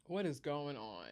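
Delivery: soft, slow, lost question; hushed bewilderment. Retold or imitated. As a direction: let it out quietly and slowly, baffled. Retold bewilderment after family's denial.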